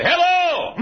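high, loud drawn-out holler; hollering hello. Retold or imitated. Preacher greeting forty folks in the dark, high hollered hello. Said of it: "hollering hello".

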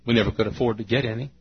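ordinary delivery

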